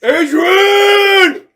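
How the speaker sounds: high, loud desperate calling; Rocky calling his wife; shouting Adrian. Retold or imitated. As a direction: yell her name across the arena. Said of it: "He's like".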